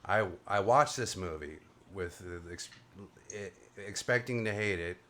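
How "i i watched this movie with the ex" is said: ordinary talk, nothing marked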